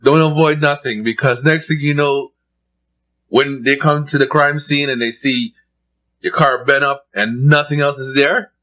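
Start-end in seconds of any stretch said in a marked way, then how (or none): none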